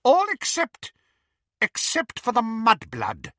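high, loud cruel witch; single her out with relish. Acted. Bellatrix to Greyback, high cruel witch.

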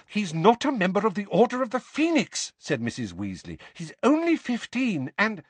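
high stern motherly voice; stern Mrs Weasley. A narrator's reading. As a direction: lay down the law like a mother.

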